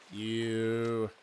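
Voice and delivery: usual speaking voice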